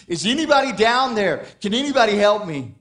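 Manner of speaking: man clinging to a branch calling for help, loud and high. Acted. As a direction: shout down into the valley, desperate. Man hanging from cliff calls.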